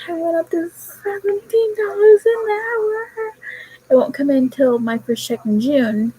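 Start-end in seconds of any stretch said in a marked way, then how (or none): 0.1-3.3 high, tearful thrilled squeal; excited crying. Retold or imitated. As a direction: squeal it through happy tears. Telling mom about pay raise.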